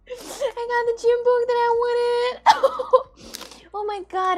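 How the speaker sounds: high, loud, teary with joy; almost crying from happiness; ecstatic, near tears. Real, not acted. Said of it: "piss my damn pants".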